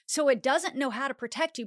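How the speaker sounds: usual speaking voice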